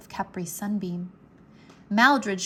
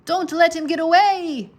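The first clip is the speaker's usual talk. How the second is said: high, loud urgent shout; urgent call; 7 semitones higher, 8 dB louder than usual. A narrator's reading. Diego shouting up to the Dragon Masters, a high loud urgent call. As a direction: call up to the riders, pressing. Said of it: "added Diego".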